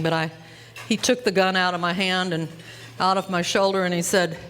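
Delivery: ordinary talk, nothing marked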